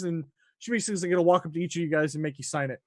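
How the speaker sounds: usual speaking voice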